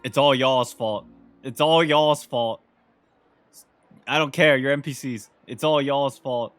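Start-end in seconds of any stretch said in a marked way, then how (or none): none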